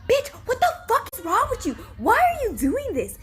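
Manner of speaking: high-pitched exasperated outburst; outraged scolding. Acted. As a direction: demand an explanation, indignant and high. Acted outburst at the manga character.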